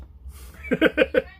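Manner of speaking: high chuckling laugh; amused laugh. Real, not acted. Host having just called Shakespeare Bill, chuckling. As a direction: chuckle at your own nickname.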